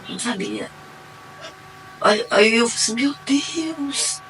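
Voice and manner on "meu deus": soft, high breath of awe; awed. Retold or imitated. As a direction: sigh it in wonder. Realizing God's plan. (suspiro de espanto, suave e agudo)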